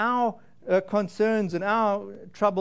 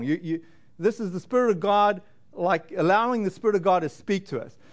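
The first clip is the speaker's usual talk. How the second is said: ordinary delivery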